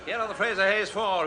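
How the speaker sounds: normal talking voice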